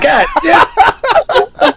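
high, laughing exclamation of disbelief; shocked god damn. Retold or imitated. Old car with antique tag.

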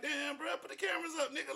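high, quick voice of an annoyed man; imitating homeless man. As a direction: play the bothered guy being filmed. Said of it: "He looking like".

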